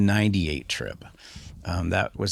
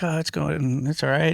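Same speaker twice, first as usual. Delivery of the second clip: higher, lightly put-on shrug of an answer; so-so reply; 9 semitones higher than usual. Imitating himself answering his friend, a lightly higher shrug. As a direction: play it off as just okay.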